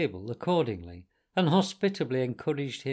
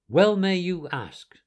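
slow, louder reproach; reproachful; 6 semitones higher, 7 dB louder, slower than usual. A narrator's reading. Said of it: "said the rat reproachfully".

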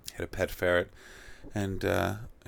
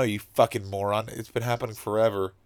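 ordinary delivery

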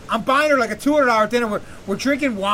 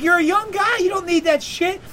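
high-pitched mock scolding; imitating pissed-off woman; 8 semitones higher than usual. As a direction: tell him off in her raised pitch. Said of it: "she's, like, all pissed off".

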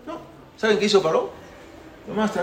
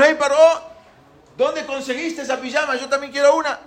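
put-on high-pitched child's taunt; teasing children; 7 semitones higher than usual. Children mocking Paró's pajamas from the window, teasing. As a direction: pipe up like a cheeky kid. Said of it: "Entonces los niños le dijeron".

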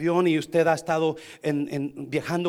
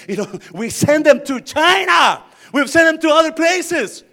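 high, slow shouted declaration; loud exclaiming sermon voice; full-force preaching; 11 semitones higher, 15 dB louder, slower than usual. Real, not acted. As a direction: belt it out to the whole room.

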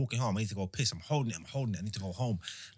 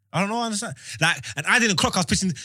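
ordinary talk

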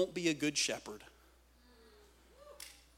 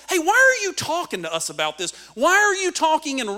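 high, loud acted questioning; raised, insistent questioning; puzzled protest; 9 semitones higher, 7 dB louder than usual. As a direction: imitate baffled followers challenging their teacher. Voices the disciples questioning Jesus.

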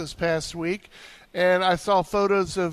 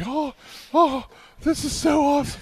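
higher, delighted gush; thrilled; 8 semitones higher than usual. Retold or imitated. Retelling his joy at the quail, higher and excited. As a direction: gush like a kid seeing quail. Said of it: "I was just like".

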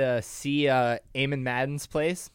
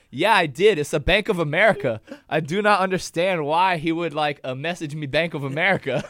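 confused, raised mock Bruno voice; baffled Bruno, louder; 4 semitones higher, 8 dB louder than usual. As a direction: act out his loud bafflement. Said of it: "he's like".

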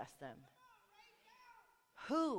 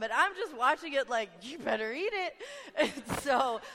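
laughing; 5 semitones higher, 6 dB louder than usual. Real, not acted. Laughing while retelling the meal.

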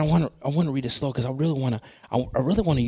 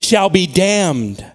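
loud, slow, raised proclamation; emphatic scripture reading; 5 semitones higher, 6 dB louder, much slower than usual. Real, not acted. Preacher reading the verse's warning to the church, emphatic proclamation. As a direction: declaim it with long pauses before the hard words.

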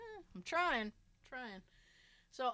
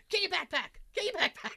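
louder, higher mock command breaking into laughter; urgent retold order; 6 semitones higher, 5 dB louder than usual. Retelling Jay's order about the backpack, raised laughing imitation. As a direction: imitate an urgent call, amused.

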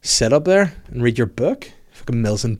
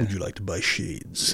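deep voice; 5 semitones lower, 8 dB quieter than usual. Pretend. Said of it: "very deep".

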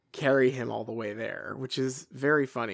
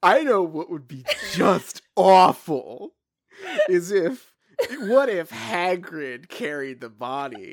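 laughing through a quote; 2 semitones higher, 6 dB louder than usual. Retold or imitated. Quoting Voldemort, laughing through it.

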